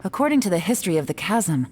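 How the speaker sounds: usual speaking voice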